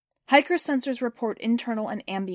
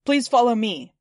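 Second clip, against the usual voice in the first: louder, even machine announcement; robot's loud new voice; 7 dB louder than usual. A narrator's reading. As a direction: project it like a robot loudspeaker. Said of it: "tell Hiker to use Hiker's new voice, eighty-five percent volume".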